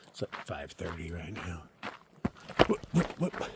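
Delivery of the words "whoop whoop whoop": high whooping calls; safety calls. Real, not acted. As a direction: whoop to warn wildlife. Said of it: "having safety on the run".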